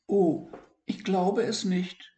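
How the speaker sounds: high, wondering little voice; amazed. Acted. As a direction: gasp in delight. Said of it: "staunte Siggi".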